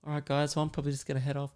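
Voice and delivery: very soft, timid excuse; awkward aside. Retold or imitated. Bystander caught in a fight, quietly excusing themself.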